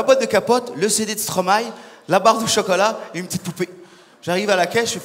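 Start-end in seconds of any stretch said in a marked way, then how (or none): none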